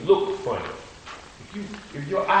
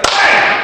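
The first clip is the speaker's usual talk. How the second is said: loud breathy "Bam!" smack noise; imitated slap; 11 dB louder than usual. Preacher acts out popping the kid upside the head, loud smack. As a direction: pop it out like a slap landing.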